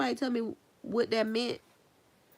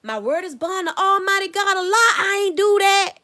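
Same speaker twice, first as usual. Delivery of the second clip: high, loud, pleading oath with a cry; childhood oath; 12 semitones higher, 12 dB louder than usual. Retold or imitated. Kid swearing to siblings, loud high pleading oath. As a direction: swear it like a kid insisting on innocence. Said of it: "We used to say".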